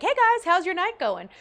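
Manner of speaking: high, loud perky hello; chatty greeting. Retold or imitated. New pool passenger greeting the car, chatty. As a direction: imitate a bubbly newcomer. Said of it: "that person was a lot more chatty".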